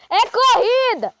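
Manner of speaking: loud excited yell; shouting. Real, not acted. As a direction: call out that it's a race. Shouting that it's a race.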